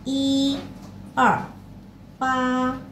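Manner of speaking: I, er, pa read with tones; toned syllables. Shown as a demonstration. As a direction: repeat I, er, pa with tones. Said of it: "Coba ulang yuk".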